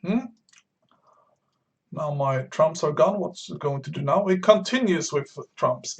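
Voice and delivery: ordinary talk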